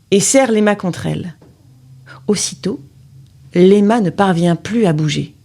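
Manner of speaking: ordinary delivery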